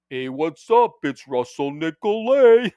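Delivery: slow, drawn-out casual dude greeting; mock a laid-back lawyer saying hi, dragging it out; Russell Nicolay impression. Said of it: "this is how he sounds".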